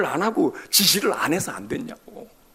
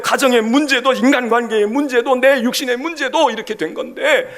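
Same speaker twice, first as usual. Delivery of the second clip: fervent plea; 8 semitones higher, 8 dB louder than usual. Acted. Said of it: "나를 펴야 돼".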